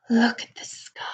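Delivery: hushed wonder; whisper. Acted. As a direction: whisper in awe.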